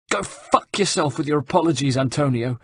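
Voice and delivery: high, pained yelp of a curse. A narrator's reading. Rosa curses Antonio in pain, a high yelp. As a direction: spit it out mid-pain, high. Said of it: "yelped Rosa".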